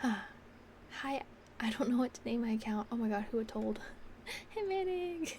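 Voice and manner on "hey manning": excited greeting. Real, not acted. Calling out to viewer.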